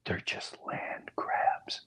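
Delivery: slow, very soft whisper; flashback whisper. Retold or imitated. Dad back in the war to his son, slow whisper. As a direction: whisper it slowly, as if hiding.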